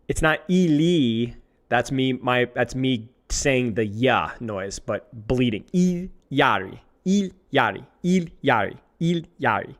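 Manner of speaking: ordinary talk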